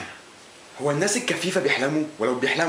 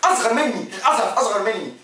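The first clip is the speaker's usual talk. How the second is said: mock outrage; 7 semitones higher than usual. Real, not acted.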